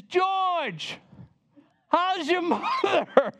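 high, bright delighted call of his name; a lady at the plane door greets him, delighted and high; surprised friendly greeting. Retold or imitated.

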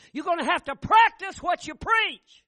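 booming pulpit cry; preaching shout. Real, not acted. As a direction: drive the point home at full force. Preacher's emphatic opening point.

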